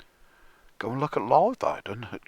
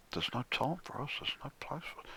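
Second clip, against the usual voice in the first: softer, fading to a whisper; spell-like murmur; 11 dB quieter, faster than usual. Retold or imitated. [name] casting a spell over listeners, hushed. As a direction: murmur it like an incantation. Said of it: "he's casting a spell".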